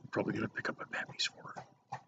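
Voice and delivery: very soft, breathy whisper. Real, not acted. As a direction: drop to a whisper. Whispered plan for sister-in-law.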